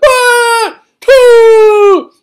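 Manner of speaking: very high, very loud sneeze blast. Retold or imitated. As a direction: explode into a sneeze. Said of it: "and it's so loud".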